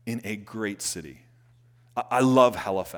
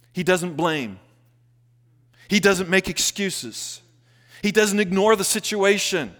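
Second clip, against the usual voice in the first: loud, high, driving sermon delivery; full-force preaching; 6 semitones higher, 6 dB louder than usual. Acted. Pastor exhorting his church with loud fervour. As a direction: preach it hard to the congregation.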